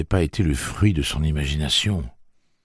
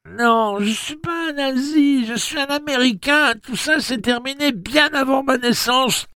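high, louder desperate plea; frantic nasal plea; 5 dB louder than usual. A narrator's reading. Jake protesting to Tic-Tac, frantic nasal plea. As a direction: blurt it out in panic.

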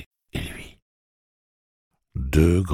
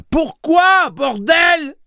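very high, loud swearing shout; shouting curse; 11 dB louder, much slower than usual. Acted. Susanna swears at Blaine: very high loud shout. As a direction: yell it with full fury. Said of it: "cria Susanna".

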